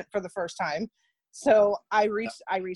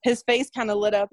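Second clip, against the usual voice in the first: ordinary talk, nothing marked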